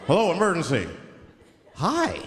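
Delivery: mock-casual phone greeting; cool and relaxed. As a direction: act unbothered on an emergency call. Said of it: "cool and relaxed".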